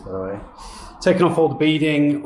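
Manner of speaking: usual speaking voice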